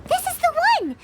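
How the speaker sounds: bright burst on finding the book; excited find. Acted. Spotting the right book on the desk, excited.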